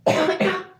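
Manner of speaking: sharp high cough; a real sick cough breaking through; coughing fit. Real, not acted. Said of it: "Sorry, I'm coughing a lot".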